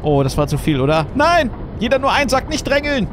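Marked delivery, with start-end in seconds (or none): 1.1-3.1 shouting. Acted. Crowd control in game.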